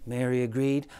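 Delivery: ordinary delivery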